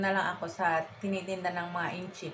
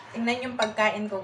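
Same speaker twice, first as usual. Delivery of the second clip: usual speaking voice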